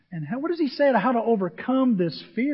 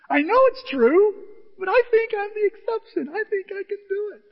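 very high, squeaky protest; hammed-up high-pitched confession; comic self-justifying; 14 semitones higher, 5 dB louder than usual. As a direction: insist in a strained high voice, starting loud.

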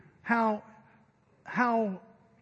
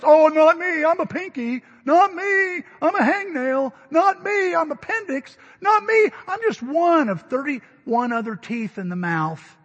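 higher, louder, dismissive squeaky voices; mock little body parts; 6 semitones higher, 6 dB louder than usual. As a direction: play the pinky who thinks it doesn't matter.

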